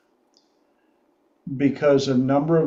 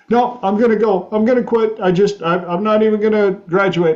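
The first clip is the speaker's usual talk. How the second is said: upset intern; 6 semitones higher, 4 dB louder, faster than usual. Retold or imitated. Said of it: "she is bawling".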